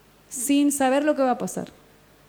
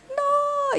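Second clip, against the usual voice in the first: anguished no; 14 semitones higher than usual. Retold or imitated. Re-enacts anguish in vision.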